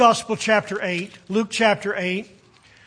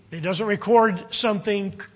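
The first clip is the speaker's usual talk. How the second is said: ordinary delivery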